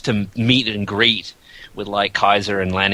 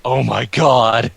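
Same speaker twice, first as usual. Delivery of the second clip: horrified; 3 dB louder, much slower than usual. Retold or imitated. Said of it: "look of horror".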